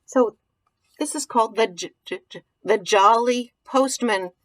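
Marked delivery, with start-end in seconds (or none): none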